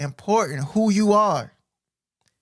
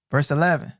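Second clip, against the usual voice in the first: ordinary talk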